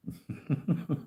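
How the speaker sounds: short high giggle; laughter. Real, not acted. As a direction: burst of high, breathy laughing. Amused by the verse.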